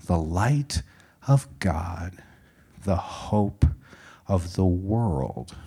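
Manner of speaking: soft, slow phrases with pauses; gentle, measured storytelling hush; hushed echo. A narrator's reading. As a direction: recall it quietly and reverently, phrase by phrase. Narrator echoes the proclamation softly.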